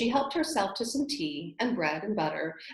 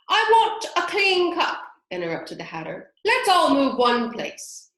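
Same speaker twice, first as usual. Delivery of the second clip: high, loud Hatter; loud, bossy Hatter; 9 semitones higher, 8 dB louder than usual. Acted.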